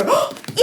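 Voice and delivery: excited gasp. Acted. Rose Red pokes the bear.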